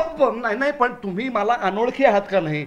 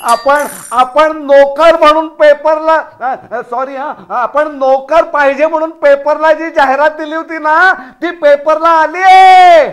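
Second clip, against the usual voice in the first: loud, excited announcement; shouting; 3 semitones higher, 7 dB louder than usual. Acted. Husband tells wife the advertisement appeared, shouting. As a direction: yell the news, building to a big finish. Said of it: "इतकं ओरडता कशाला आहे?".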